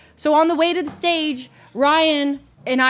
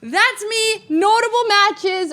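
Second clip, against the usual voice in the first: excited echo; 11 semitones higher, much slower than usual. Real, not acted. Streamer hearing casters say her name, thrilled. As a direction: shout her own name back delighted. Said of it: "featuring Leslie Fu".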